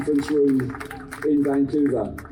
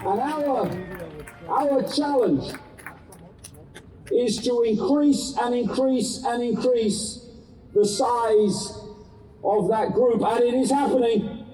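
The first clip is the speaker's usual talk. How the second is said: urgent activist; 6 semitones higher than usual. Acted. Rousing speech to crowd.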